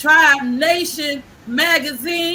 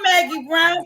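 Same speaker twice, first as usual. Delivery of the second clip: usual speaking voice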